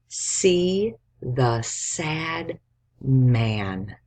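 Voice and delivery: See the sad man stretched very slowly; slow dictation. Shown as a demonstration. Teacher dictates See the sad man slowly. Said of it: "give you a sentence to write".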